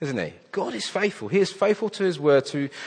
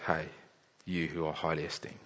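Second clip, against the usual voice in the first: softer, gentle angel reassuring a frightened man; tender reassurance; 8 semitones lower, 15 dB quieter than usual. Acted. Angel comforting a fallen Daniel, soft and tender. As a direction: hush it down like comforting someone trembling. Angel's words repeated softly.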